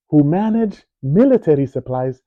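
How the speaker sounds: plain reading voice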